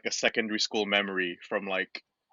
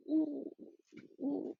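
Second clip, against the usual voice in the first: high, soft cooing "ooh"; mock impressed oohs; 8 dB quieter than usual. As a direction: imitate admiring relatives with high little oohs. Imitating family's cooing admiration.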